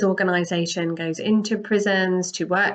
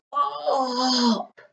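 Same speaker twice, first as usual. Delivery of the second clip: mock yell; 7 semitones higher than usual. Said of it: "Eventually just yells".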